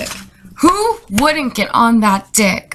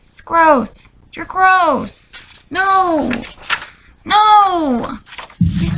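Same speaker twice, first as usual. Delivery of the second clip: disgusted; 7 semitones higher, much slower than usual. Real, not acted. Disgusted rant at the guy.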